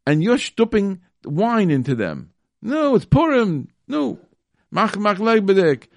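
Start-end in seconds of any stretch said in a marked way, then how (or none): 2.6-5.9 mock host. Mocking host pushing wine.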